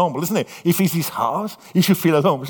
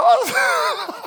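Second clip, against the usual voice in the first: very high squeaky laughing oh; deflated realization, overplayed; comic high oh; mock dismay. Realizing the toast never came.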